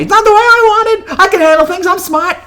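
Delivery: high-pitched, hammed-up indignant outburst; overacted wounded shouting, held back from full volume; loud movie impression. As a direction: protest loudly in a strained high voice. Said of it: "I do it more loudly".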